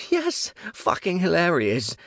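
high, worked-up exclamation; sudden high-pitched outburst; manic glee. A narrator's reading. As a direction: burst out as if unhinged. Manic reply to own question.

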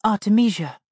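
put-on high, shy child; a small girl answering timidly; little girl voice. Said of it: "the bowed head whispered".

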